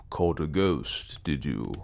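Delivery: low, unhurried character reply; dry response. A narrator's reading. Sanderson answers his friend's ghost claim, dryly. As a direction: say it slowly, dropping low at the end.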